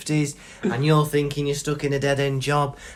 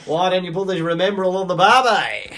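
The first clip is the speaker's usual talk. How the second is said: hammed-up Aussie voice, pitched up; Australian accent; 4 semitones higher, 4 dB louder than usual. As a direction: tease with a stock Australian impression. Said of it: "in an Australian accent".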